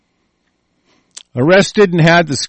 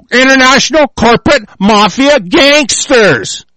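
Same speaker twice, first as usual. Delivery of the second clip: raised, heated ranting; angry rant; 4 semitones higher than usual. Real, not acted. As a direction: rail against the police as armed gangsters.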